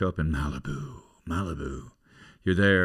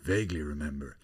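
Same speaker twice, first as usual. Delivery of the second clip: lower, softer, hesitant reply; reserved film star; 5 semitones lower, 7 dB quieter than usual. Acted. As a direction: answer the pushy fan quietly, holding back. Film star's reluctant reply.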